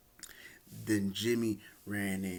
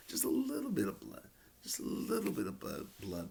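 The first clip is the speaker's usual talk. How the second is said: much higher, pleading repetition; higher wistful plea, half joking; playful longing; 7 semitones higher than usual. Real, not acted. Wishing for blood in match.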